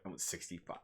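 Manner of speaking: very soft, understated quote; hushed retold reply. Character retold answering how big he went, hushed understated reply. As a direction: drop right down to quote the answer.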